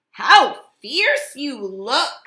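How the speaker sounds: impressed Hermit Crab. A narrator's reading. Crab impressed by the urchins, slow and loud. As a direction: savour the word fierce slowly. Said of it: "said Hermit Crab".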